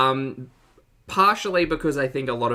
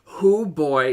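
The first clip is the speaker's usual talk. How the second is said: ordinary delivery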